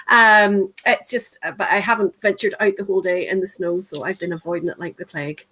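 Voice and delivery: usual speaking voice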